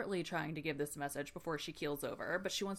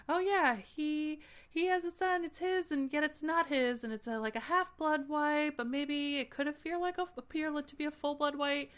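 mock rambling messenger; 8 semitones higher, 4 dB louder than usual. Messenger explains the fool's son to Fitz, higher vague ramble. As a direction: ramble the prophecy half-heartedly. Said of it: "she's like".

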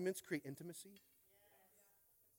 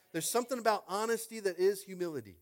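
ordinary talk

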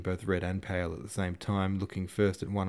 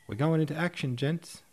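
high, eager call to comrades; keen rallying cry; excited announcement; 8 semitones higher, 3 dB louder than usual. A narrator's reading. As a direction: announce it with lifted pitch. Officer hails the order to advance.

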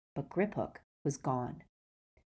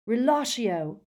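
higher, louder, forceful incantation; yelled spell; 6 semitones higher, 5 dB louder than usual. A narrator's reading. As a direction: yell it like casting a spell. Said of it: "he yelled".

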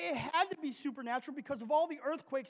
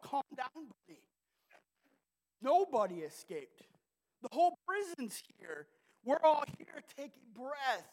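retold shout; 3 semitones higher, 5 dB louder than usual. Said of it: "he shouts out".

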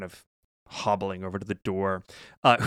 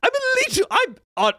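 higher, clipped restraint over anger; stern wizard Ganbald; 5 semitones higher, 6 dB louder than usual. Acted. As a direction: insist you're not mad while clearly mad. GM voices Ganbald scolding the party.

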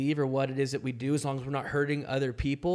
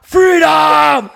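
much louder, higher slow Scottish rallying shout; Scottish battle cry; 11 semitones higher, 19 dB louder, slower than usual. Pretend. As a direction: roar it like a Scottish rebel leader. Braveheart-style battle cry quote.

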